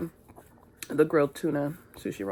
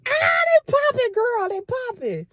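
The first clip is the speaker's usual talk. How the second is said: hyped; 7 dB louder than usual. Real, not acted. Showing off her hair.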